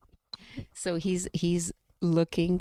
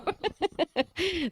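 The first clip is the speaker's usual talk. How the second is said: gleeful laugh; 12 semitones higher than usual. Real, not acted. Laughs at reveal.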